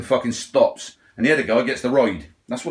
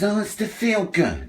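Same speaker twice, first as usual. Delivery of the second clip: slow, higher sing-song mockery of what everyone says; first take pitched up and drawn out; mocking quote; 5 semitones higher, slower than usual. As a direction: parrot the excuse with mock sweetness. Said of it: "everyone says".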